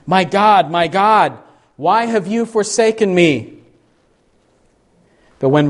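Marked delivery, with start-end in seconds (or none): 0.0-3.6 higher-pitched, anguished appeal to God; crying out. Acted. Jesus on the cross crying out to his Father, crying out. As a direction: cry out to God, voice lifted high. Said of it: "Jesus cried out".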